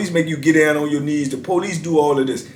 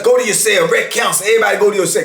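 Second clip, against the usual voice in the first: higher-pitched mock calling out; acted-out order to a crowd, pitched up; retold guard's announcement; 6 semitones higher, faster than usual. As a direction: imitate a tower guard ordering everyone away. Retold tower guard ordering everyone.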